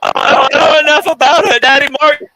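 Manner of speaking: high, loud mocking parody of his words; mocking whiny voice. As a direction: sneer it back at him in a high whine.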